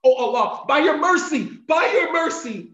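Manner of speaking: imitated desperate plea, loud and high; yelling out. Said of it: "and he yells out, he calls out".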